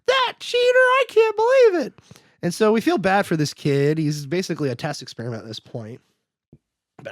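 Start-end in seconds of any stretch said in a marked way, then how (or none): 0.1-1.9 put-on very high, squeaky outraged kid. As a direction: squeal it like a betrayed little boy. Kid outraged at being tricked.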